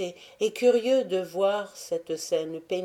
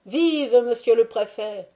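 cheering acclamation; 5 semitones higher, 6 dB louder than usual. Acted. Workers acclaim the prefect.

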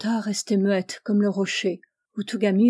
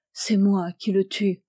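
ordinary delivery, nothing marked

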